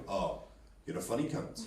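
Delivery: low, quiet, cold threat; menacing tone. Acted. Someone who is no friend you've made, menacing tone. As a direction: drop it low and hushed, meaning the opposite of praise. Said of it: "it can come down to tone as well".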